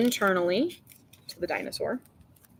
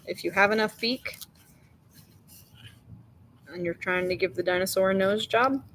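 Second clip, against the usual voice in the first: ordinary talk, nothing marked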